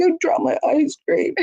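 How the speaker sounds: high, overplayed childlike upset; exaggerated upset. Shown as a demonstration. Parent modeling upset over dropped ice cream: high childlike whine. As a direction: whine it like a kid who lost a treat. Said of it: "we might go".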